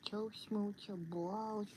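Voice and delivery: ordinary delivery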